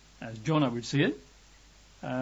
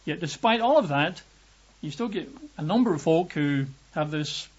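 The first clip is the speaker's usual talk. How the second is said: normal talking voice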